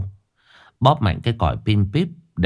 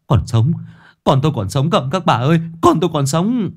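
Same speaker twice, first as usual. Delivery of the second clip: overjoyed; 7 semitones higher than usual. A narrator's reading. Father telling the women his son is alive, overjoyed. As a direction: cry out the news in relief. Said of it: "Chú mừng rỡ".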